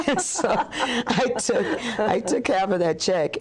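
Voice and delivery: words squeezed out through laughter, pitched high; laughing while talking. Real, not acted. Telling the host about the bank, laughing through it. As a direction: laugh your way through the line.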